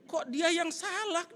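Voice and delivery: high, drawn-out disbelief; indignant protest. Retold or imitated. Indignant reaction to rider. (protes tak percaya, nada tinggi)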